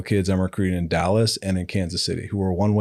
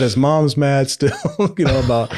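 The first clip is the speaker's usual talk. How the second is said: laughing; 5 semitones higher, 4 dB louder than usual. Real, not acted. Laughing about bitter mother.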